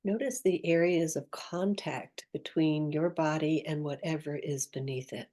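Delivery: soft, unhurried meditation voice with long pauses; guided meditation. Acted. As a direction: gently guide the listener inward, leaving space after each phrase.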